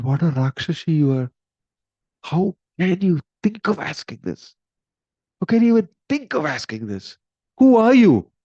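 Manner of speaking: high, loud appalled cry; horrified outrage. Retold or imitated. Queen screaming at Mohini over her son. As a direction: rage at her, rising to a shout.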